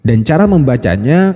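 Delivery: usual speaking voice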